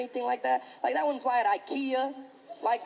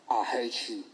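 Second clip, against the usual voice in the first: menacing bully; 13 semitones lower, 5 dB quieter than usual. Retold or imitated. Bully on the bus to another kid, low and menacing. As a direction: growl it low like a creepy little psycho.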